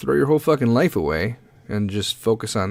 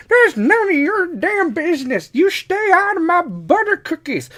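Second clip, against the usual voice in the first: put-on grumpy old person. Grandparent scolding grandkid over butter cookies, high crotchety voice. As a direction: squawk like a cranky grandparent guarding the cookies. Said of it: "Grandpa, the candy's been in here".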